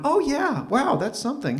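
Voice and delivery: a lightly voiced coworker gushing on cue; mock impressed. Coworker hearing the story, lightly voiced impressed gush.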